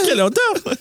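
laughing high-pitched quote. Retold or imitated. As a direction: squeal it through laughter. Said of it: "c'est quoi qu'elle dit en français".

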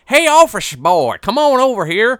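high, loud hammed-up Kentucky accent. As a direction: bark it out like a Kentucky colonel. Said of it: "Think Kentucky".

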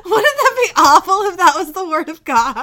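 laughing hard. Real, not acted. Joking about kilts, crying with laughter. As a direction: cry laughing.

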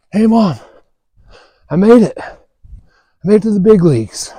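high, bursting with joy; excited son. Retold or imitated. Son telling his parents he made the big leagues, thrilled. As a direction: shout the big news to Mom.

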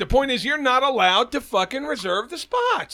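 high-pitched, exasperated arguing; heated protest. Real, not acted. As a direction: insist indignantly on your point. Argues with teasing co-host.

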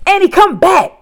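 high, loud desperate shout; urgent full-voiced holler. A narrator's reading. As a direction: yell after someone running away. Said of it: "Jack shouted".

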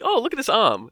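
high, loud interruption; C-3PO. Retold or imitated. Droid barging into a reunion, high and chatty. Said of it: "he's like".

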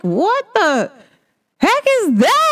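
high incredulous squeal; mock outrage. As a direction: exclaim at the scale. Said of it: "I'm like".

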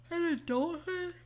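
high, stretched yawn on the words. Real, not acted. Host muses on adulthood to listeners, yawning mid-phrase. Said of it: "so sorry".

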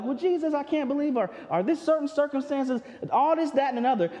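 high-pitched whiny grumble at Jesus; grumbling complaint. Retold or imitated. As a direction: complain like an excuse-making disciple. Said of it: "we wanna grumble and be like".

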